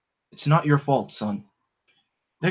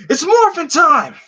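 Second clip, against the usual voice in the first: battle cry; 7 dB louder than usual. Acted. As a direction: shout it out to transform. Hero morphs, battle cry.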